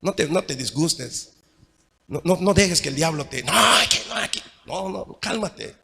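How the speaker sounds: soft, hushed calming words; soothing, calming. Retold or imitated. Hugging an angry brother, urging him softly to calm down. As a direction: gently talk an angry brother down. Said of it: "tú vas y lo abrazas y le dices".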